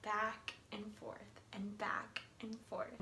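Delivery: soft slow whisper. A narrator's reading. Mother rocks her grown son, whispered. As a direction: whisper it like rocking a sleeper.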